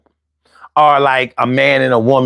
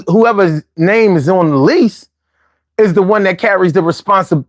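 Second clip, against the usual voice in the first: normal talking voice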